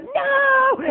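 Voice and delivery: very high, drawn-out wail; mock terrified cry. Evil kid is rushed toward the wall, high panicked wail.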